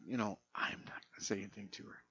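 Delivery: fast whispered aside; hushed, quick conspiratorial whisper. Retold or imitated. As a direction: whisper quickly so she can't hear. Hiding laughter from his wife.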